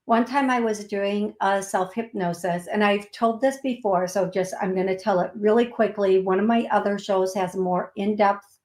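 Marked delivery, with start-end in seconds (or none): none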